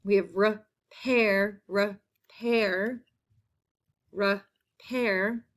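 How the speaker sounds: repair said slowly, the pair drawn out; slow modelling. Shown as a demonstration. Teacher slowly modelling a spelling word. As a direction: stretch out repair for the class. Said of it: "in that second half".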